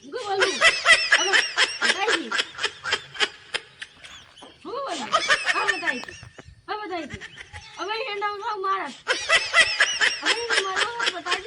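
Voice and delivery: put-on slurred drunken shouting; loud drunk yelling with a laugh; town drunk. Drunk husband shouting threats.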